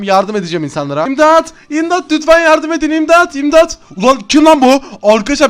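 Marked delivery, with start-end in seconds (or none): 1.1-3.8 higher, louder panicked calls for help; a victim yelling for rescue; acted cry of a child in danger. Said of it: "imdat sesi geliyor". 4.0-4.8 louder, higher angry outburst; angry challenge. Acted. Hero hears cry, confronts.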